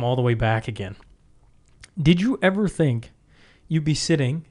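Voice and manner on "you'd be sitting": ordinary delivery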